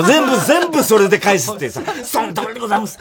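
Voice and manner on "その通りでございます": humble evasive reply breaking into laughter; mock evasive apology. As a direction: dodge the question meekly. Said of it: "みたいなさ".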